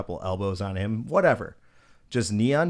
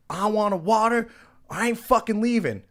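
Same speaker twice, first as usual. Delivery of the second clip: much higher, louder mock protest; escalating belligerence; 12 semitones higher, 5 dB louder than usual. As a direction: imitate him digging in, pitched way up. Retold man escalates his refusal.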